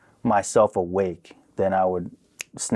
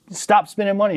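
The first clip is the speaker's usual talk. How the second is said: higher-pitched mock scolding; father's phone scolding; 11 semitones higher, 3 dB louder than usual. Father calling his daughter about spending, mock scolding. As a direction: retell a dad laying down the law. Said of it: "he would call her and be like".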